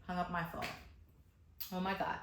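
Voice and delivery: ordinary talk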